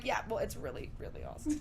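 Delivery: whispered, soft; soft whispered aside. Retold or imitated. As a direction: breathe it out under your breath. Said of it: "But I'm like".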